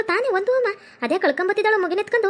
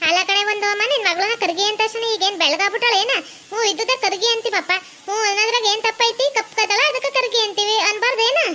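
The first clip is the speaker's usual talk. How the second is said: slightly high, put-on old-woman voice; defensive mother-in-law; 5 semitones higher than usual. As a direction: argue back as the unrepentant granny. Plays mother-in-law defending the nickname. (ಸಮರ್ಥಿಸಿಕೊಳ್ಳುವ ಅತ್ತೆಯ ಧ್ವನಿ)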